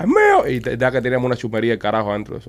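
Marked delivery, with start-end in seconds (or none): 0.0-0.5 high, loud imitated holler; retold shout, pitched way up; shouted reply. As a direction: yell back across the store. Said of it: "alguien grita por allá".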